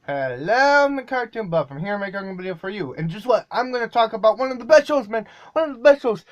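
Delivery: hammed-up cartoon host voice, fast and loud; loud and high, bursting with cartoon excitement; over-the-top cartoon host. Shown as a demonstration. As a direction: sell it like a wacky kids' show host. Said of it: "let's try to do that more over the top".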